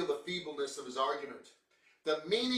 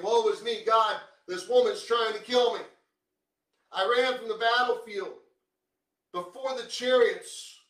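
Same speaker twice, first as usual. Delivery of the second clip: whiny pity party; 8 semitones higher, 11 dB louder than usual. Retold or imitated. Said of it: "Elijah's throwing a pity party".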